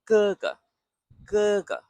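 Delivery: Ge ge with a quick back g and no puff, high and level; high steady Ge ge, no aspiration; 哥哥，第一声; Mandarin tone one. Shown as a demonstration. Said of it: "this ge is at the back, quick, no puff".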